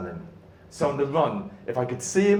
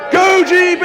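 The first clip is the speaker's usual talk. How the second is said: much higher, much louder stadium yell; cheering Great Britain's athlete home; crowd shouting; 15 dB louder than usual. Retold or imitated. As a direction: roar it like the whole crowd at the finish. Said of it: "I can hear the crowd shouting".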